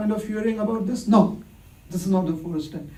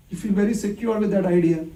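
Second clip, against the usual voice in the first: usual speaking voice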